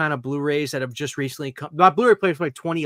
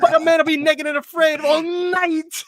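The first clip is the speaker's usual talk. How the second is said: high, louder put-on leprechaun voice; imitating the leprechaun ad; 13 semitones higher, 6 dB louder than usual. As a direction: belt the jingle like a cheeky leprechaun. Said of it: "they're doing the".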